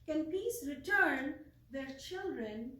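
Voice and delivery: impassioned, declamatory poem reading with drawn-out words; measured poetic recital with long pauses and ringing emphasis. Acted. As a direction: recite it like a solemn lament, swelling on key phrases. Let me read my poem.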